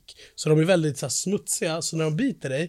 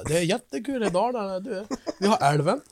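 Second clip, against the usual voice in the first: higher, singing Dalarna dialect; dalmål; 4 semitones higher than usual. Pretend. Proud local boasting of Dalarna, in mock dalmål. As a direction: put on comic dalmål, pitch rising as it goes. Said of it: "Ja, Dalarna".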